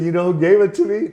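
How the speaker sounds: hammed-up tease. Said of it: "And I said".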